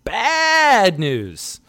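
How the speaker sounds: BAD stretched out long, loud and high; stress on BAD. Real, not acted. Host mocking Philly accents, exaggerated stress on bad. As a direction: blow up the word bad, hugely drawn out.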